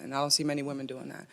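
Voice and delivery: ordinary talk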